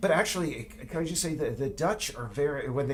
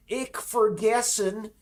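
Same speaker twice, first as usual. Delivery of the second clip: loud, slow hammed-up fake German; mock a Texan's broken German, loud and slow; mock bad German; 10 semitones higher, 9 dB louder, slower than usual. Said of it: "That is not German".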